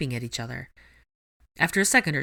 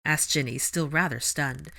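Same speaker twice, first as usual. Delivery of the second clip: ordinary delivery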